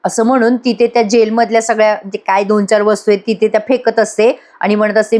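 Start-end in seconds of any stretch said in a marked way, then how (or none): none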